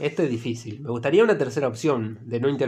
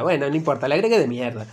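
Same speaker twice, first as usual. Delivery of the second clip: usual speaking voice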